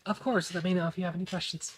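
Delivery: quick, soft server's reassurance; tired half-elf server. Acted. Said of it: "kind of tired, middle-aged half-elf".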